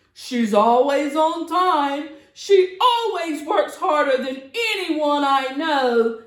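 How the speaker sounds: proud Santa presenting his sister; hearty Santa toast; Santa's speech. Acted.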